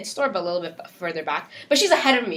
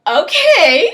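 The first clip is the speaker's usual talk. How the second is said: stunned okay; 7 semitones higher, 10 dB louder than usual. Retold or imitated. Stunned reply to manager.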